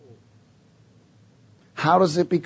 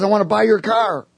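higher-pitched, lighter imitated call; stranger's retold call; 7 semitones higher, 3 dB louder than usual. Big guy offering to buy my car, lightly imitated call. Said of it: "And he says".

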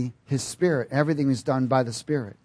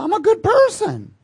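much higher, louder smug whine; mock smug good person; 6 dB louder than usual. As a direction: parody a self-satisfied believer, pitched way up. Mocking a self-righteous churchgoer.

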